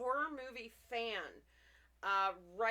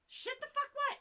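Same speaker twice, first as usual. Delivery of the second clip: startled outburst; 4 dB louder than usual. Real, not acted. Startled by something on screen.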